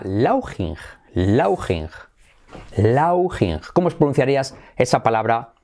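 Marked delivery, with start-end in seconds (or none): 0.0-3.6 "Lauching" with "lau" and a Spanish j sound; read as spelt. Shown as a demonstration. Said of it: "así leída sería Lauching". ("Lauching" leído tal cual, a la española)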